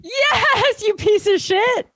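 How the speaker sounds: high, slow, playful jab; hammed-up fake outrage; mock insult. Guest answering whether she's sober, playful mock snap.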